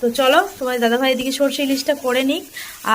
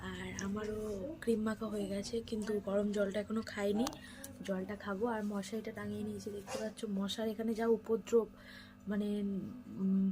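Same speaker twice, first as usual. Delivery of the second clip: speaking softly; 11 dB quieter than usual. Real, not acted. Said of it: "আর আমি একটু আস্তে আস্তেই কথা বলছি".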